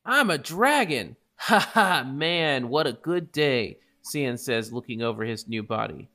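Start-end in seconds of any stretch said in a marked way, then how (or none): 0.1-3.8 excited. Acted. Sans delighted at being dragon.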